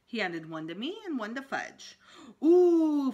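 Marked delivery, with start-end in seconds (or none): 2.4-3.1 high, loud delighted coo; excited ooh. A narrator's reading. Fudge thrilled at getting a lollipop, high delighted ooh.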